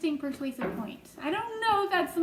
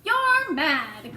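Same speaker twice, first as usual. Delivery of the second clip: louder outburst of disbelief; scornful cry; 3 semitones higher, 7 dB louder than usual. A narrator's reading. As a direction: cry out that he's mad. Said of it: "cried the earthworm".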